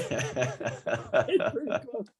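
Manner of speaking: slow, laughing through the words; slow amused repeat laughing at own joke. Real, not acted. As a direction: chuckle the line out again slowly.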